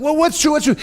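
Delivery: high, hurried demand to know; acted-out excited questioning; urgent questioning. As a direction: cut in, alarmed and confused. Startled disciples question the excited arrivals.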